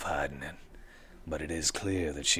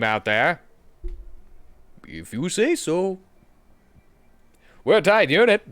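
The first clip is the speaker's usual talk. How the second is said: higher, louder boastful recruiter; merc recruiter voice; 8 semitones higher, 11 dB louder than usual. Acted. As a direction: sell the merc team with swagger.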